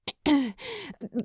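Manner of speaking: awkward, too loud throat clear; nervous loud ahem to cover embarrassment. Acted. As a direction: clear your throat to break the moment. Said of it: "Clearing her throat a little too loudly".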